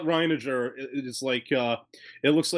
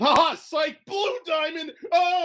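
gleeful gotcha in a much higher voice; excited; slower than usual. Pretend. Acting out the show's reveal.

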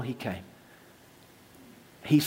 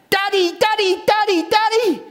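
put-on pestering child; 8 dB louder than usual. Child running to greet dad.

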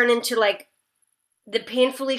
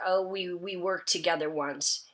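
soft, low and bashful; modest, hushed admission; 5 semitones lower, 10 dB quieter than usual. Retold or imitated. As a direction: say it quietly, like someone looking down. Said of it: "looks down and he's like".

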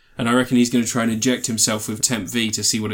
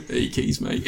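whispered deadpan reply; 5 dB quieter than usual. Retold or imitated. Acting out the dog answering.

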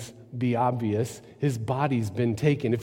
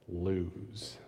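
hushed; 8 semitones lower, 14 dB quieter than usual. Real, not acted. Preacher on meanness that loses, hushed. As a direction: drop the word to a hush. Vision of violence losing.

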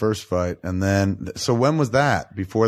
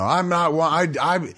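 mock sidekick rebelling; 7 semitones higher, slower than usual. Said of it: "Robin's growing up".

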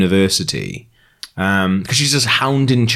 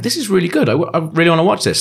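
higher-pitched, keen imitation of a friend; retold enthusiasm; 6 semitones higher than usual. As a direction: relay her delight in a raised voice. Quoting friend's delight at show.

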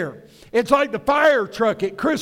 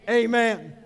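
normal talking voice

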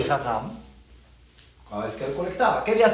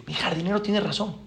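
quiet aside; 8 dB quieter than usual. Retold or imitated. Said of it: "Y empieza".

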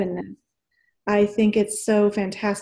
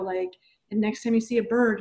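ordinary talk, nothing marked

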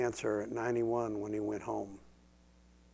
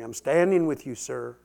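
louder, declaring support; rising preacher declaration; preaching build; 8 dB louder than usual. Real, not acted. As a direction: proclaim it to the sister.

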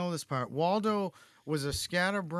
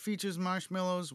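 ordinary talk, nothing marked

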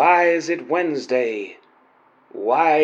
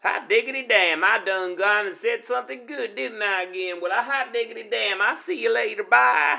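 put-on cowboy accent; 6 semitones higher, 3 dB quieter, much faster than usual. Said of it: "interesting accent you've got there".

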